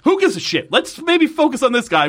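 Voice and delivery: exasperated outburst. Real, not acted. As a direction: pitch shoots high in disbelief. Mocking the time cops' priorities.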